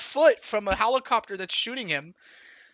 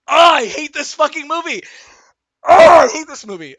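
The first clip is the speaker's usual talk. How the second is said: loud, laughing groan at the movie; overwhelmed, half laughing, half despairing; laughing exasperation; 7 semitones higher, 14 dB louder than usual. Real, not acted. Streamer cracking up at the tense movie, laughing outburst.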